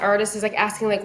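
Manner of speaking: usual speaking voice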